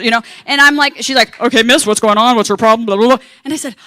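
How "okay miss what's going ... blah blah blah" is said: loud, brisk no-nonsense attendant; Nurse Ratchet. Retold or imitated. Stern attendant questions panicking passenger, loud and brisk. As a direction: demand answers like a stern matron. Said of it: "Nurse Ratchet of the flight attendants".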